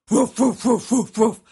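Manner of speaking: loud, repeated woofs; dog barking. A narrator's reading. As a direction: bark like a dog, in sharp bursts. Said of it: "so he started barking".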